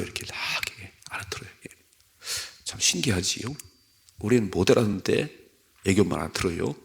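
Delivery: ordinary talk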